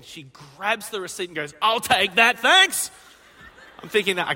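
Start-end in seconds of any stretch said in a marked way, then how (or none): 1.6-2.9 loud, high and brisk, snatching the check; wife loudly claiming the bill; bold grab. Retold or imitated. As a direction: announce it boldly as you swipe the receipt. Wife grabs lunch receipt boldly.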